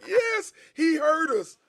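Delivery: fans cheering high and slow, imitated; mock fans cheering. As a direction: stretch out a gleeful yes. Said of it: "we were like".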